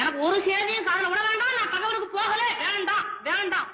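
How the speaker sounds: high, wailing protest; distraught refusal. Acted. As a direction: swear it all off in a high cry.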